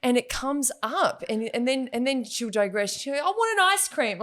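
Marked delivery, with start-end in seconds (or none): 3.2-4.2 higher little-girl whine, lightly voiced; child demand. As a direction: play the kid switching topics. Daughter's sudden change of topic.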